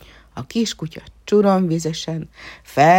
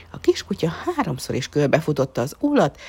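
ordinary delivery, nothing marked

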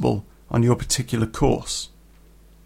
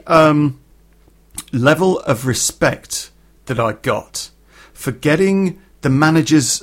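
ordinary talk, nothing marked

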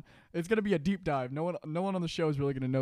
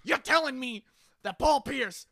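Stephen A Smith; 9 semitones higher, 6 dB louder than usual. Acted. Friend plays Stephen A ranting on sports TV, loud and outraged. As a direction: blast it like a TV pundit outraged on air. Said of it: "I'm gonna go full Stephen A".

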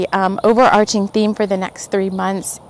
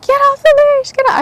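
high-pitched, louder mock yelling; retold yell; 6 dB louder than usual. Dog owner to man standing on the leash, mock yelling. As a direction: call out urgently in a high voice. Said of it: "yelling at him".

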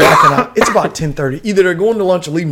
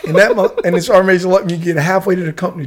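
ordinary talk, nothing marked

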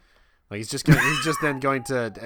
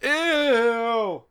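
mock disgust; 11 semitones higher, 6 dB louder than usual. Disgust at the punchline.

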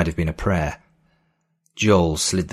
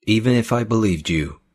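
trembling voice; 4 semitones higher than usual. A narrator's reading. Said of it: "his voice trembling".

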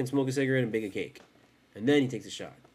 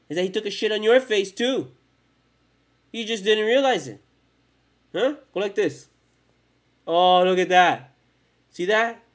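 mocking rant; 7 semitones higher, 8 dB louder than usual. Real, not acted. Mocking the viewers, rising rant.

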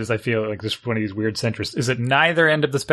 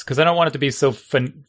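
ordinary talk

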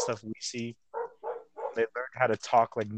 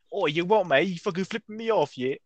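brash, higher, louder mock Brit "you what, mate"; mock British accent; 7 semitones higher, 6 dB louder than usual. Mocking how British people talk, exaggerated British accent. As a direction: ham up a rowdy British bloke. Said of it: "why did British people talk like that".

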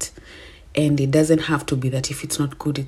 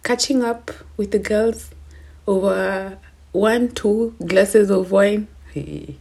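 playful; 6 semitones higher than usual. Real, not acted. Playful retelling of night out.

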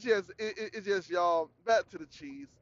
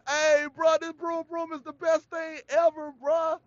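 high, eager customer hype; hyped review; 9 semitones higher than usual. Retold or imitated. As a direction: rave about the broom broom to the brand. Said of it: "Then you tell them".

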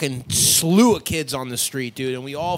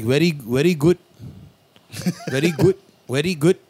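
mock Indian accent, V turned to W; 4 dB louder than usual. Shown as a demonstration. Demonstrating the sound swap to friends, Indian accent. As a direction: put on an Indian accent, "weddy good". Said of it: "V's or W's".

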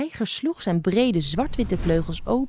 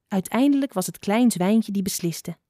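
plain reading voice

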